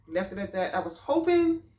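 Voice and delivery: ordinary talk, nothing marked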